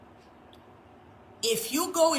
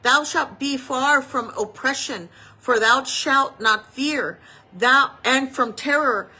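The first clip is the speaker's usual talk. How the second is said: louder, preaching the verses; scripture recitation; 7 dB louder than usual. Acted. Preacher proclaiming scripture to the congregation, louder and preaching. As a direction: proclaim it as promise.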